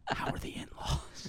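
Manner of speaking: slow whispered small talk; ASMR whisper. Pretend. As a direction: whisper slowly like an ASMR barber.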